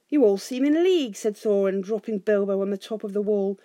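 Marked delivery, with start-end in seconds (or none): 0.1-1.2 high, loud, indignant outburst; angry accusation. A narrator's reading. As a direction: accuse them all with outrage. Thorin accuses them all.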